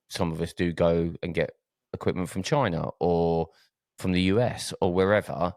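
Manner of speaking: normal talking voice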